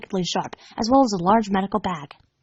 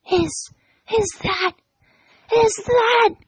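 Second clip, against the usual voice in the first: high, breaking into a cry; shocked stammer; 11 semitones higher, slower than usual. Acted. Dash recognizing the griffin skull in shock. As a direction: choke out the question in horror. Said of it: "Dash gasped in shock".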